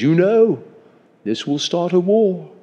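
put-on high English-accented nobleman. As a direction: give it as a high-pitched warning in an English accent. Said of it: "He didn't say it with an English accent".

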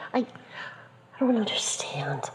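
whispered, barely voiced; shocked whisper. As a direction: breathe it out in disbelief. Esther stunned by the crucifixion news.

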